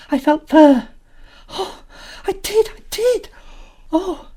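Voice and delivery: put-on very high, loud excited girl; thrilled squeal. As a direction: squeal with discovery. Anthea excited finding fur.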